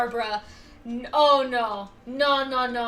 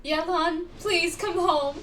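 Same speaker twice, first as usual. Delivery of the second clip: normal talking voice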